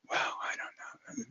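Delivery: slow, unvoiced whisper; whispered patient reply. Imitating the ex-Marine patient's vague reply, slow whisper. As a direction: hush it out slowly with no voice. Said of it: "he talked like this in a whisper".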